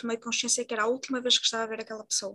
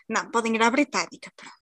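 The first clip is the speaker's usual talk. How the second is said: usual speaking voice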